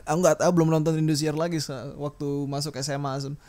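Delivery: ordinary delivery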